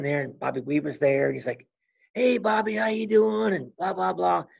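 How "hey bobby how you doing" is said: high, friendly mock greeting; lightly voiced cheery hello; chummy greeting. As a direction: play the overly friendly host. Weaver greeting, mimicked chummy.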